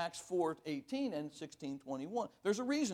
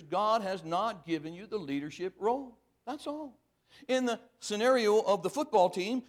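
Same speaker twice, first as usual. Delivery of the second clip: normal talking voice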